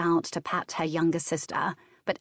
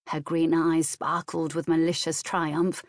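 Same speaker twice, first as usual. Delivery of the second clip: usual speaking voice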